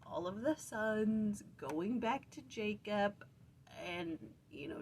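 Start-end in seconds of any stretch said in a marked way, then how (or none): none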